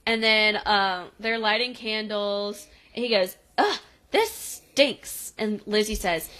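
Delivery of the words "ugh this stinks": put-on high, slow grossed-out kid; retold kid's revolted complaint; mock disgust. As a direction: drag it out in exaggerated disgust. Voicing disgusted kid over candles.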